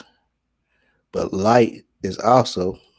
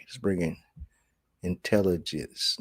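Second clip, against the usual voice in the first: ordinary talk, nothing marked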